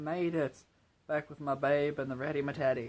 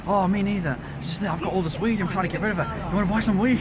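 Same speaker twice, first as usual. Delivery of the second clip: imitating the weed seller; 5 semitones higher, 4 dB louder than usual. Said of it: "He's like".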